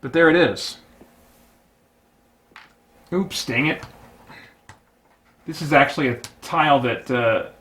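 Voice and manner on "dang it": ordinary delivery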